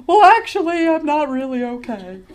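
high, loud, laughing confession; breaking admission. Retold or imitated. Teller admits to the woman she is not okay, high and loud. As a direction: burst out the truth half-laughing.

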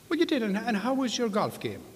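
very high cheery leprechaun. Acted. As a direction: squeak the question up high. Leprechaun greets golfer again.

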